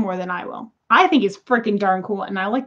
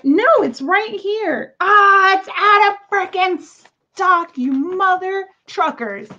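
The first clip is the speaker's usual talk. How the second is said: high, loud, slow exasperated rant; frustrated outburst; 10 semitones higher, 4 dB louder, slower than usual. Real, not acted. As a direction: vent at the store, much higher. Item out of stock, frustrated.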